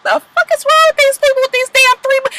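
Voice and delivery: high, exasperated squeal; high-pitched what-the-hell rant; outraged disbelief. Retold or imitated. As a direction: shriek it in disbelief. Her own outraged reaction.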